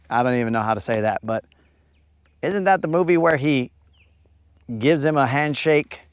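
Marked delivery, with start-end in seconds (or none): none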